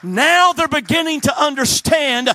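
loud, high, sung preaching cadence; preaching whoop. Acted. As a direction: build into a chanting climax over the organ.

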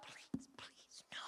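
barely audible whispered begging; whispered plea. Retold or imitated. Son dreading she'll run his dad off, whispered plea. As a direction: whisper it as a desperate silent prayer.

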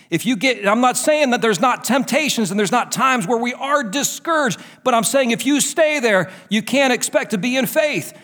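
preaching. Acted. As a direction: drive it home like a revival preacher. Preaching at full force.

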